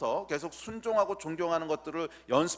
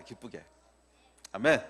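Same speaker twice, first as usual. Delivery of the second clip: usual speaking voice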